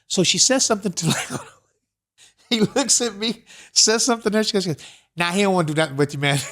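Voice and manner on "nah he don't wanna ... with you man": quick quote breaking into laughter; amused imitation of a blunt refusal; laughing retelling. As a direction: rattle off the brush-off, then crack up.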